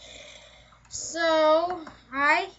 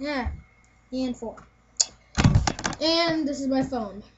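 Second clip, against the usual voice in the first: ordinary delivery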